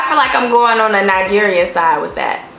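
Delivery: ordinary talk, nothing marked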